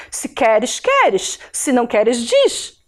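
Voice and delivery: mock the Santa Catarina lilt, S as sh; sing-song island accent, "queres" said "quéresh"; Florianópolis accent. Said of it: "um catarinense fala assim".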